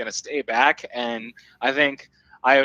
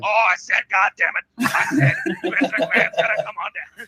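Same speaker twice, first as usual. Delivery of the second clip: higher, hammed-up boss growl with a laugh breaking through; Vince McMahon voice; 7 semitones higher than usual. Podcast host asked to do his Vince voice, hammed-up impression. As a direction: play the blustering wrestling boss. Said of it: "you have to do your Vince voice".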